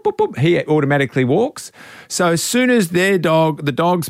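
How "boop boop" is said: high, slow beeping like a pedestrian signal; sing-song electronic boop boop boop; crossing beep imitation. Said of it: "goes boop".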